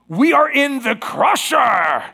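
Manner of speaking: high, drawn-out triumphant proclamation; arena announcer voice. Acted. Preacher rallying the congregation, mock arena announcer. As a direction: announce it like a monster-truck intro.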